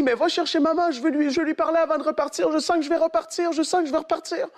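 high, fast urgent plea; urgent pleading boy. Retold or imitated. Boy urging grandmother to fetch his mother, fast and high. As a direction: hurry her, you're slipping away.

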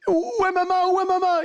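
put-on high pleading boy's voice. Dying boy asking grandmother for his mother, high pleading. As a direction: beg like a scared teenager.

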